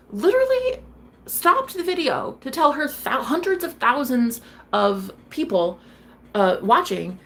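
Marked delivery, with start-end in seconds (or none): none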